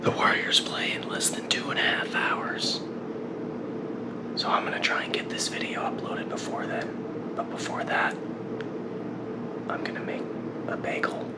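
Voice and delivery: soft breathy whisper, not to wake anyone; hushed morning whisper. Real, not acted. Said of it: "I'm whispering".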